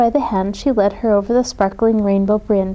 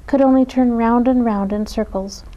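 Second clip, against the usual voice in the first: usual speaking voice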